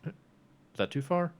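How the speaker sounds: normal talking voice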